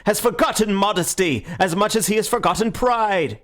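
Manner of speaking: high, ringing public tirade; fiery oratory. A narrator's reading. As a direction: declaim it to a cheering hall, pitched high. Syme's tirade to cheering anarchists.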